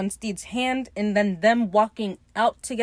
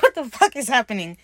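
loud, higher incredulous outburst; mock the baffled onlookers, loudly; mock bewilderment; 4 semitones higher, 7 dB louder than usual. Baffled Danes reacting to the chaos, mock bewilderment.

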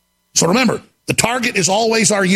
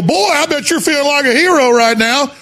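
mocking quote; 5 semitones higher than usual. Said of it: "And they go".

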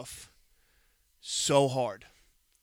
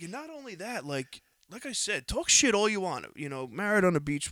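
heated rant; 6 semitones higher, 6 dB louder than usual. Retold or imitated. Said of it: "And I'm like".

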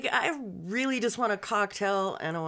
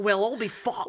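put-on Irish accent; 2 semitones higher than usual. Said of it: "that's how you do the Irish one".

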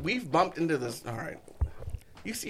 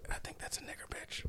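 whisper; 16 dB quieter than usual. Retold or imitated. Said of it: "He looks at me like".

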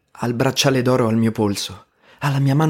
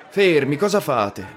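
shouting; 4 semitones higher than usual. Retold or imitated. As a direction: call out over the brawl to halt it. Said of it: "afferra il microfono e urla".

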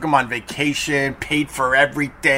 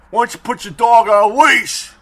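louder, higher imitation of an irate beachgoer; mock angry stranger; 7 semitones higher, 6 dB louder than usual. Beach guy confronting dog owner, hammed-up louder angry demand. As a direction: play the guy jumping in your face, indignant. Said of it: "He goes, uh".

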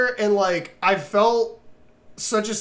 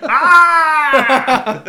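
pained scream; 7 semitones higher, 10 dB louder than usual. Retold or imitated. Victoria hit by truck.

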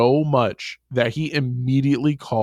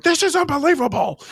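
mock outburst, much higher and a touch louder; outraged executive; 3 dB louder than usual. Picturing the chairman with eighties glasses, hammed-up high outrage. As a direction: ham up a flustered eighties suit losing it. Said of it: "pictured this dude with nineteen eighties glasses going".

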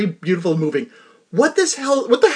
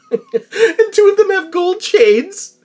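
slow, laughing through the words; trying not to laugh; 11 semitones higher, slower than usual. Real, not acted. Cracking up describing men.

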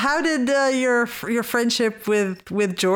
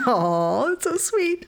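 touched aww; 7 semitones higher than usual. Real, not acted. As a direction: gush over how sweet it is. Host touched by son story.

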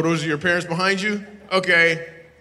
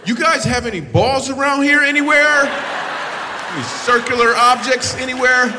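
mock appeal; 10 semitones higher, 7 dB louder than usual. Real, not acted. Joking appeal to the crowd.

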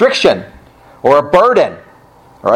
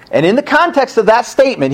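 ordinary talk, nothing marked

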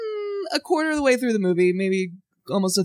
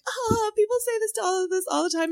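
higher mom voice; 11 semitones higher, 5 dB quieter, faster than usual. Retold or imitated. Quotes her mother.

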